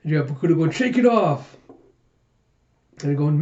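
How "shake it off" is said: high, raised teammate's shout; pitched-up cheer; encouraging call. Acted. Bokuto calls to teammate.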